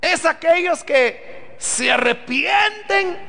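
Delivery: slow, ringing pulpit proclamation, every phrase hammered home; preaching at full force. Acted. Preacher to his congregation about the new creation, full-force preaching.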